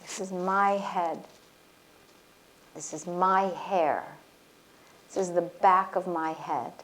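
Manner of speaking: guided healing exercise, gentle; guided affirmation. Acted. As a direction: lead them calmly through each body part. Guided body reclaiming exercise.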